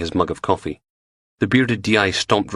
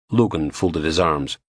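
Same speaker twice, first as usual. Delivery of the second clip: normal talking voice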